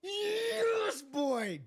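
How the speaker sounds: high, loud victory yell; triumphant shout. Real, not acted. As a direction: celebrate at the top of your lungs.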